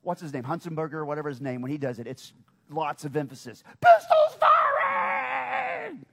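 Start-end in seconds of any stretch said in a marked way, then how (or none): 3.8-6.1 huge high drawn-out yell; announcer shout. Retold or imitated. Preacher contrasting it with his plain mention, booming shout. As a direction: belt it like a stadium announcer. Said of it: "if you heard the guy".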